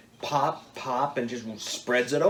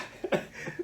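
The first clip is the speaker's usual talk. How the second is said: soft laugh; 6 dB quieter than usual. Real, not acted. Laughs again.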